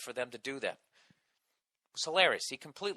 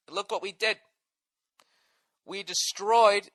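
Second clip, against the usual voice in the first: ordinary talk, nothing marked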